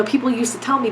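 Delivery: ordinary talk, nothing marked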